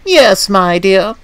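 high, slow, drawn-out agreement; condescending coach, drawling up high; haughty old coach. A narrator's reading. Second coach backing up the first, haughty.

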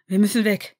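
called out. A narrator's reading. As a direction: call out to flee. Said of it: "rief Ron".

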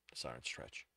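usual speaking voice

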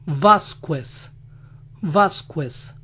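Vázquez with the u said, vaskwes; u sounded. Shown as a demonstration. Showing how Americans sound the u in Vázquez. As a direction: say Vázquez as vask-wes. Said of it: "En Vázquez pronuncian la U".

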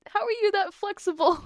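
high, loud incredulous question; amazed disbelief. Acted. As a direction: exclaim it, astonished by the feat. Astonished at seeing the splits.

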